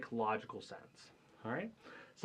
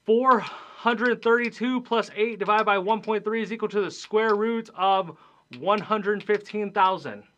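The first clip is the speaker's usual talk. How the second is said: high and loud, the long sum read out; booming read of a math example; 7 semitones higher, 8 dB louder than usual. Shown as a demonstration. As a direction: announce the whole equation loudly. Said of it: "that is a no".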